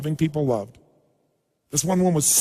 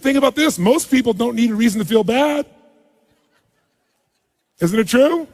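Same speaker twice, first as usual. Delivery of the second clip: louder, pumped-up seminar preaching; rally preaching at full force; 4 semitones higher, 4 dB louder than usual. Real, not acted. Coach to seminar audience, pumping them up with loud energetic preaching. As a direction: whip the crowd into energy, full voice.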